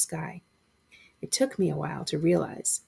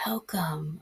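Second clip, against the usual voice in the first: softer, rasping ghostly whisper; hoarse whisper; 5 dB quieter than usual. A narrator's reading. Shadow boy welcomes him in a hoarse whisper. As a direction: rasp it out barely aloud. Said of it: "a boy said in a hoarse whisper".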